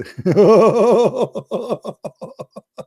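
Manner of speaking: long, high-pitched pulsing laugh; high cackling laugh. Real, not acted. Man laughing at his hello gays mistake, high cackle. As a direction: cackle helplessly at your own slip.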